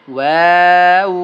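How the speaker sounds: ওয়াও loud with rounded lips, drawn; rounded waw. Shown as a demonstration. Teacher showing lip-rounded ওয়াও. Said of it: "দুই ঠোঁট গোল করিয়া ওয়াও পড়তে হয়".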